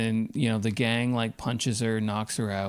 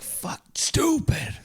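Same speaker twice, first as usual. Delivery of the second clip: a breathy high whisper; mock self-reproach whisper; whispered curse; 11 semitones higher, slower than usual. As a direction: curse himself under his breath. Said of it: "better if he was like".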